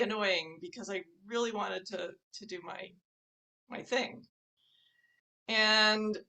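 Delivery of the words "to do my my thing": ordinary delivery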